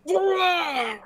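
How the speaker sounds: high shrieking death cry; death sound. Pretend. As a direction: screech like a dying monster. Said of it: "can I do the death sound for him".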